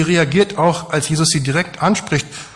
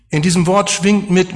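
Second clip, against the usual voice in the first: usual speaking voice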